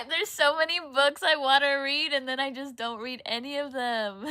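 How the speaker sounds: loud, exasperated outburst between laughs; laughing exasperation. Real, not acted. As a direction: burst out loudly, laughing at yourself. Laughing exasperation at her unread books.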